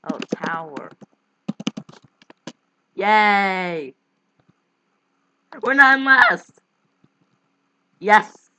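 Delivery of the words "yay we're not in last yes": excited cheering. Real, not acted. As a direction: shout for joy, real relief. Escaping last place in drawing game.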